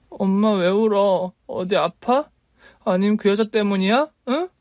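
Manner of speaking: put-on young daughter asking her crying mother; worried child. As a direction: ask gently like a scared child.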